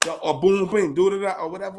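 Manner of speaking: ordinary delivery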